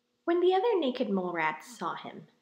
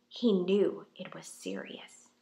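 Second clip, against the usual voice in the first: ordinary delivery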